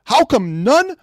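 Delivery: high-pitched, heated rant; mad. Real, not acted. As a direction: vent at the reviewers. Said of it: "and I'm sorry I'm mad".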